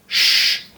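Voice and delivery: whispered soft sh. Shown as a demonstration. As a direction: hush like quieting someone. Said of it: "más suave y va a sonar sh". (sh suave, como cuando callas a alguien)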